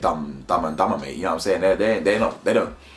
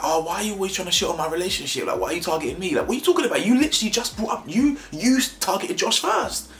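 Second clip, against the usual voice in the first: much higher mocking voice of Georgia, rising indignation; mock Georgia, high and whiny; 11 semitones higher than usual. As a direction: squeak it out like an outraged Georgia. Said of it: "And then Georgia's like".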